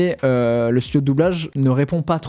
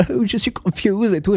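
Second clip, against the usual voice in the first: a hint of a posh British accent in French, higher; lightly faked British accent; mock British accent; 6 semitones higher than usual. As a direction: imitate a British shoe-selling shark in French. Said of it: "faire l'accent en français en mode".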